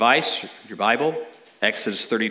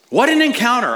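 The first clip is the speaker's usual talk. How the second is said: awed exclaim; 12 semitones higher, 5 dB louder, faster than usual. Real, not acted. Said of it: "exclaims after the reading".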